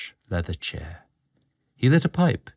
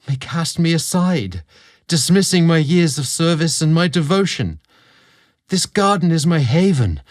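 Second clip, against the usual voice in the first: put-on a young servant's voice, raised and louder, full of worry; anxious young servant; 6 semitones higher, 5 dB louder than usual. Julian tells Leo his fear of losing his place, voice raised and anxious. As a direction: pour out your fear of being cast aside.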